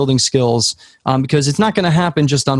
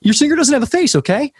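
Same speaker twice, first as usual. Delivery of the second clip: Mike's blunt verdict; 13 semitones higher than usual. Retold or imitated. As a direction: squeak it out like a brash mentor scolding. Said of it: "He's like".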